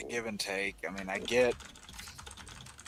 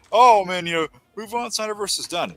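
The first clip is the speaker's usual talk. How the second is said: mocking online fans; 8 semitones higher, 5 dB louder than usual. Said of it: "People are saying".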